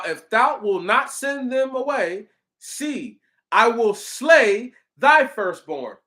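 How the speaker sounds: high, slow, forceful declaration; scripture proclamation. Acted. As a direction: proclaim God's threat, leaning hard on key words. Reads God's threat from scripture.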